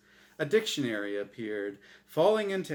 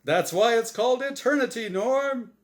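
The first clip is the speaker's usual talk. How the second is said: booming, bright host voice; game show host; 11 semitones higher, 8 dB louder than usual. Acted. The angel Azrael hosting his show for Norm, big host voice. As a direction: sell it like a TV quiz host.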